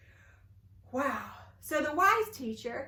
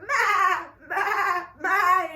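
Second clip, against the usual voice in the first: high, loud goat bleats, louder on the last; 6 semitones higher, 6 dB louder, slower than usual. Acted. Goats bleating in story.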